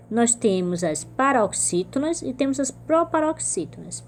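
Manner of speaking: ordinary delivery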